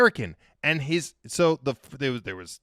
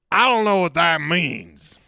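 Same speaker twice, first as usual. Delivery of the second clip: loud, high, drawn-out tourist outburst; imitating a baffled tourist yelling; mock indignant shout; 6 semitones higher, 12 dB louder, slower than usual. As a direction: holler it slowly like an exasperated oaf. Retold outburst of American tourist.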